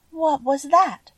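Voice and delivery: high, timid question; a timid girl startled by a noise. A narrator's reading. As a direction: ask it high-pitched and a little fearful. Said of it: "she asked timidly".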